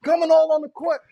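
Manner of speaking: playful indignant shouting at a calm partner. Real, not acted. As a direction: rile him up with fake outrage. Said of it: "why you not getting excited with me".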